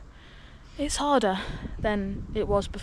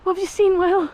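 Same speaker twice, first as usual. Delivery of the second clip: excited; 9 semitones higher, 6 dB louder than usual. Real, not acted. Spotting the car at walk's end.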